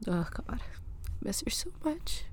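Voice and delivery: tearful broken whisper; barely holding back tears; after crying. Real, not acted. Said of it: "Sorry, I'm a mess".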